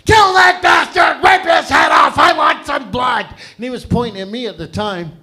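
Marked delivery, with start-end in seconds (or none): 0.0-3.2 loud high furious yelling from the stands; a dad in the arena howling at the ice; screaming hockey dad. Retold or imitated. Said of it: "my dad was into hockey violence".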